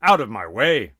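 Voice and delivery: loud barked order; commanding shout. Acted. Shiva shouting at the boy guarding the door. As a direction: bellow it at the boy. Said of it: "and he said".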